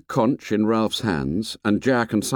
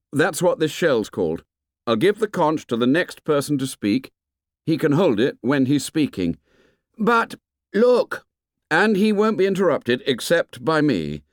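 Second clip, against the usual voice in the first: higher, lightly voiced boy; boy leader explaining; 7 semitones higher than usual. A narrator's reading. Ralph explains the conch to the boys, earnest and higher. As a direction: explain the rule with earnest, raised pitch.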